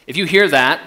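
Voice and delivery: ordinary talk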